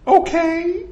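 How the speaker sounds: meek, questioning falsetto; very high cartoon-mouse reply; Mickey. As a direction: squeak a doubtful assent.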